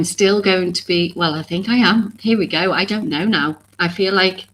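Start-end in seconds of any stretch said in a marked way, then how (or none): none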